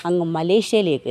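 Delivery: slow, loud announcement of the destination; dramatic reveal. Retold or imitated. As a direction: spell it out big and proud, slowly. Son reveals the surprise trip. (പതുക്കെ, ഉറക്കെ, നാടകീയമായ പ്രഖ്യാപനം)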